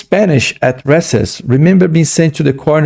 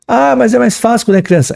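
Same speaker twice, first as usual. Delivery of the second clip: mock student objection; 10 semitones higher than usual.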